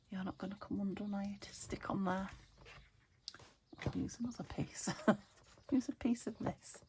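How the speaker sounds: whispered muttering to herself; whispering. Real, not acted. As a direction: breathe it out quietly. Muttering to tape while crafting.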